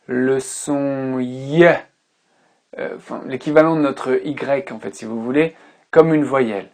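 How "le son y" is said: "le son y" drawn out very slowly; slowly stressed. Shown as a demonstration. Teacher naming a sound for the class, slow and deliberate. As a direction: dwell on the sound y. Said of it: "l'anglais ne considère pas".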